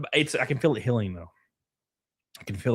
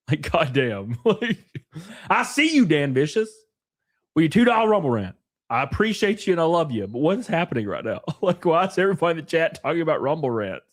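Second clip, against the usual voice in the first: ordinary talk, nothing marked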